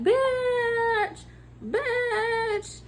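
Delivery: high-pitched, drawn-out disbelief; incredulous retort. Real, not acted. Worker answering a rude customer in her story, high incredulous drawl. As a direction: stretch each word out high in indignation.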